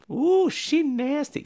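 high put-on young woman's voice; high girlish voice. Danielle Harris's character asks Tony Todd, high girlish. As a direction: ask it wide-eyed in a high voice. Girl comments on the story.

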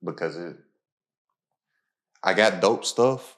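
usual speaking voice

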